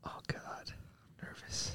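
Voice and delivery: nervous whisper, slow. Real, not acted. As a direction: breathe it out nervously. Whispered aside.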